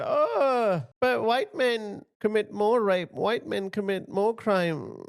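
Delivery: lightly voiced sneering mimicry, drawn out; mocking liberal women. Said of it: "Those silly liberal Western women who say".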